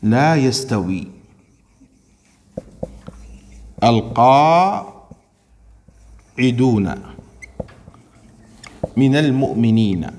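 unhurried Quranic recital, line by line; Quran recitation. Shown as a demonstration. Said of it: "الله عز وجل يقول في القرآن الكريم".

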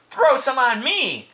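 high, slow begging call; boy's cry. A narrator's reading. Boy wanting water too, high cry. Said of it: "cried Avery".

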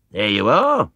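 loud proud announcement; triumphant. A narrator's reading. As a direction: crow about your success. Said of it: "he announced triumphantly".

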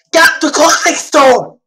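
high, loud, drawn-out yell; yelled punchline. Real, not acted. Gamer riffing on gap jumps, yelled punchline. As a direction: yell it out, stretching the words. Same words repeated, yelled.

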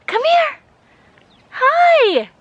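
high sing-song call to a dog. Real, not acted. Mom calling loose neighborhood dogs, high sing-song call. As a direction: coax the dog, high and bright.

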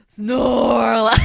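huge drawn-out creature bellow; Snorlax impression. Fan guessing the sound, loud Snorlax bellow. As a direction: bellow the name, stretching it until the voice gives out. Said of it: "what I think it sounds like".

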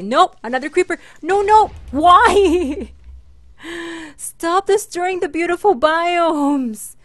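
high, loud panicked no, no; begging the creeper to stop, slow on the last plea; distressed yelling. Real, not acted. Creeper destroying her world.

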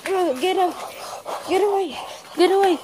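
high, urgent cries; panicked warding off. Acted. Driving the clown away, panicked. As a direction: push the intruder back in panic.

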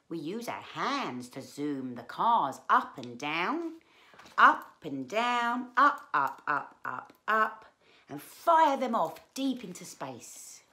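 loud, swooping, high storytelling; animated story narration. Acted. Reader zooms toy cars for children, excited storytelling. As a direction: zoom the words up like racing cars. Reads excited racing-car play aloud.

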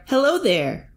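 cheery, high hello; bright greeting. A narrator's reading. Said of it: "A bright".

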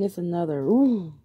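ordinary talk, nothing marked

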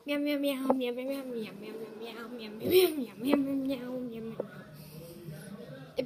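playful string of cat meows; meowing cats. Pretend. Host meowing as the nine cats, fading out. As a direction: meow like a row of cats. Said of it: "I have to stand up for this".

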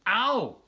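loud, high cry of pain. Acted. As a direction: yelp as if the tusk stings. Cub hurts tusk on ice.

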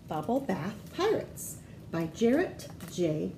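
plain reading voice

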